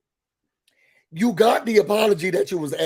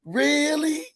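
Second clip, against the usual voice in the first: higher, drawn-out "really"; surprised reaction; 7 semitones higher than usual. Retold or imitated. Hearing about a friend's date, surprised. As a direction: react to juicy news.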